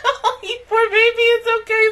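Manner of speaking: put-on high, laughing baby talk; teasing comfort for a scared friend; giggly poor baby coo; mock consoling. Consoling friend after prank.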